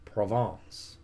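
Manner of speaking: Provence in a light French accent, Pro-VAHNCE with a nasal end. Shown as a demonstration. Streamer names a French province in a light French accent. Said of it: "I say with a French accent".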